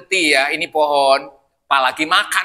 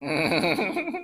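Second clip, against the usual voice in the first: soft laugh; 8 dB quieter than usual. Real, not acted. Audience-facing laugh.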